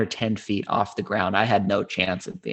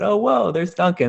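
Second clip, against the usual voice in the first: excited; 10 semitones higher, 6 dB louder than usual. Retold or imitated. Excited spotting the comedian.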